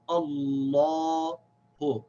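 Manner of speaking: "Allahu" drawn out, rounded aw held long; slow model of "Allahu"; full long vowel. Shown as a demonstration. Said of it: "just know that you pronounce it".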